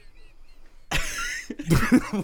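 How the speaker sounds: high unvoiced wheezing laugh; wheezy laugh. Real, not acted. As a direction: laugh until you wheeze. Laughing at David.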